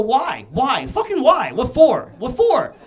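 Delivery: loud, high demand of why; incredulous shout. Real, not acted. Comic ranting at the crowd, loud and incredulous. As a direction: yell the question in disbelief.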